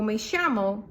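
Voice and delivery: llamo said "shamo"; sh sound. Shown as a demonstration. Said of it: "those are all correct".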